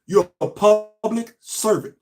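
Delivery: slow, heavy weight on servant in "You're a public servant"; stress on servant. Real, not acted. Vlogger lecturing the governor, slow with servant stressed. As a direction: lean hard on "servant", each word drawn out. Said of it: "Emphasis on servant".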